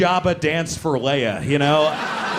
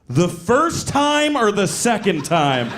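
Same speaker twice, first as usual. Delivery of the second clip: slow, louder, higher retort; indignant friend; 6 semitones higher, 4 dB louder, slower than usual. Retold or imitated. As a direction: act out an incensed friend, drawing out the question. Friend angrily answers apology in retold scene.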